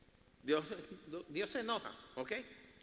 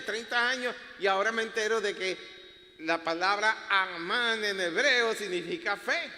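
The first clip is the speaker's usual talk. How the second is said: ordinary talk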